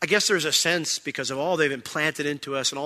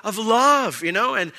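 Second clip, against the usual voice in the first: ordinary talk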